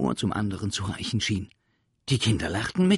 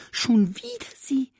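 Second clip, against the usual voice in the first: teasing creature; 14 semitones higher, 5 dB louder than usual. Acted. Sams teases teacher.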